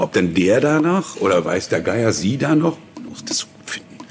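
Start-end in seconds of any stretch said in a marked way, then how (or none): 3.1-4.0 whispered, unvoiced; hushed aside. Whispering what the clients think about the text. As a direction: drop to a whisper.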